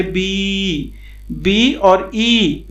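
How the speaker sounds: बी stretched slowly so the trailing ई rings out; slow demonstration of the ई sound inside बी; drawn-out letter sound. Shown as a demonstration. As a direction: draw out बी to expose its long "ee" ending, then ई. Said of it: "बी के बाद ई भी sound हो जाता है".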